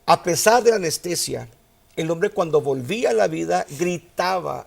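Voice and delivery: ordinary talk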